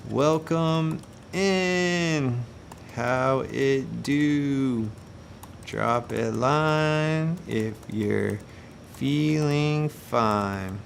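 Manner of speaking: very slow, soft, drawn-out welcome; slow chat greeting. Pretend. Host greeting the chat, very slow and soft. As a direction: stretch each word out like a laid-back host. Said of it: "let me hop in the chat and say".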